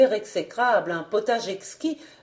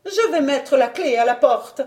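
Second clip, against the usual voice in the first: higher-pitched wifely announcement; declaring wife; 5 semitones higher, 4 dB louder than usual. A narrator's reading. Angry wife announces to her husband, firm high declaration. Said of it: "elle se leva en déclarant".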